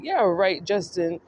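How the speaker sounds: ordinary talk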